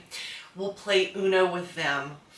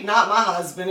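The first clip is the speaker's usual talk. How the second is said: ordinary talk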